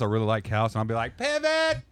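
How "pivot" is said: high-pitched frantic yelp; Ross from Friends. Acted. Host carrying a couch in costume, high frantic mimicry. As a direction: call out the word in a strained high voice. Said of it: "I'm gonna be Ross".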